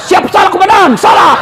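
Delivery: high shouted soldier reply to his commander; policeman yelling siap salah. Retold or imitated. A policeman answering his angry commander, shouted. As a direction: bark it out like a scolded cop snapping to attention. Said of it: "di kantor dimarah-marahi sama komandannya".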